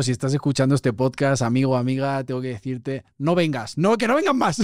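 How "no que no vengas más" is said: súplica fingida, mucho más aguda; ruego en broma. Pretend. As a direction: suplica en broma, subiendo mucho el tono. Bromea suplicando que no vengan. (súplica exagerada de broma)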